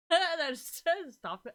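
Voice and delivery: high, loud, slow words broken by laughs; laughing. Real, not acted. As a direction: crack up mid-sentence. Cracks up while telling the story.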